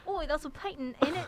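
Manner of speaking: cheeky fake London voice; bad British accent. [name] riffing on UK slang, hammed-up Cockney accent. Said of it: "That's enough of bad British accents".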